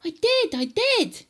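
high, loud, insistent George; indignant high-pitched boy's shout. Acted. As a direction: shout back up at Grandma. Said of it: "George shouted".

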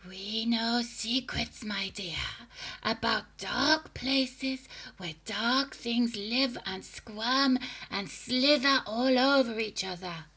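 slow breathy sinister hag whisper; throaty witch whisper. A narrator's reading. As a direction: whisper dark secrets menacingly.

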